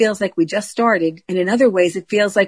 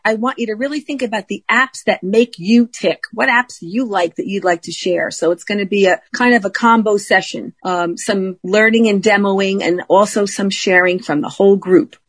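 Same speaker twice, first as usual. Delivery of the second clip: ordinary talk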